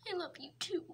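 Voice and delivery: soft whispered love; tender whisper. Acted. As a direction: whisper it sweetly. Tender reply to partner.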